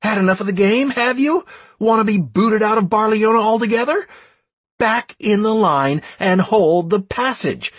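high, heated rebuke; angry dressing-down. A narrator's reading. Leader berating Plinto and would-be deserters, angry. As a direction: scold them hard and drive them back in line.

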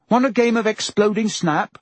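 put-on high, eager timid boy; chirpy and a little nervous; Neville voice.

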